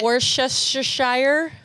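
Worcestershire said slowly and carefully; unsure pronunciation. Real, not acted. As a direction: sound out Worcestershire syllable by syllable, slowly. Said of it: "I don't know if that's how you say it".

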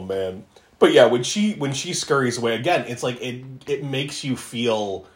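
ordinary talk

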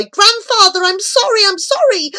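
high, loud, pleading apology; anguished cry. A narrator's reading. Horrified boy to fallen grandfather, anguished high cry. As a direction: cry out in desperate remorse. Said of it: "He opened his mouth to cry".

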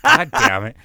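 loud frustrated curse at the red light; demo of the curse. Shown as a demonstration. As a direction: blurt it hard. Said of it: "I just said it like that".

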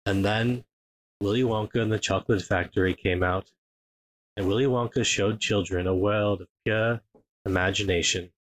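low, even documentary narration; soothing monotone narrator. Retold or imitated. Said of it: "soothing monotone".

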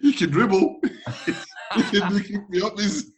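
high, laughing through the words; laughing while talking. Real, not acted. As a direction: giggle out the joke. Laughing, joking defence.

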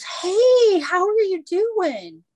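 high, loud, drawn-out friendly hello; excited stranger greeting. Retold or imitated. Strange lady greets Nelson at the burger joint, high excited gush. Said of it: "one of the ladies goes".

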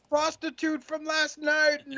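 very high, drawn-out comic howl; stretched high-pitched mock despair; mock wail. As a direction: cry out in hammed-up horror. Acting out trucker losing his belongings.

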